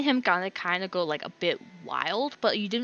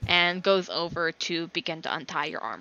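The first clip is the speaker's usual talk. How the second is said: ordinary talk, nothing marked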